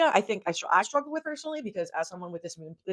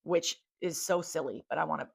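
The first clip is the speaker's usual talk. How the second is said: ordinary delivery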